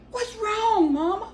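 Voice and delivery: very high imitation of a worried daughter; alarmed daughter. Daughter running in to her screaming mother, alarmed.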